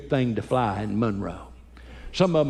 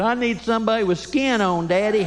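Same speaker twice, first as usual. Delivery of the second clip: put-on higher childlike reply; high little boy; 8 semitones higher than usual. Chad answering his dad in a high kid voice. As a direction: deliver the kid's clever line sweetly. Smart son quoted.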